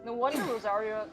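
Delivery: usual speaking voice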